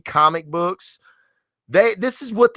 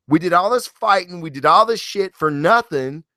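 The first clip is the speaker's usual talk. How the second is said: ordinary delivery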